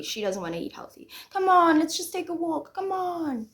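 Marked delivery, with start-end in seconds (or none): 1.3-3.5 high, pleading nudge; imitated coaxing. He coaxes her to take a walk, mock pleading. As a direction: play him begging lightly, pitch held up.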